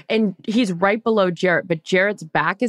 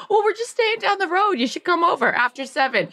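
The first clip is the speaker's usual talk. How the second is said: lightly voiced eager husband; 11 semitones higher than usual. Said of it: "He's like".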